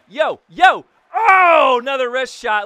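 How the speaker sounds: loud high hype yell; hyped game yell; excited shout. Real, not acted. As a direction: shout at the shot. Shot on net.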